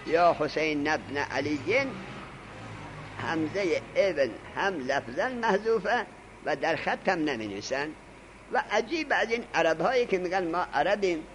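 ordinary delivery

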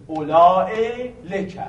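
اولا said as long olā-e before لکه. Shown as a demonstration. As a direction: put a stretched ā and hamza in اولا, then لکه. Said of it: "با حالت مد تلفظ بکنید". («اولا لکه» با مد و همزه در «اولا»)

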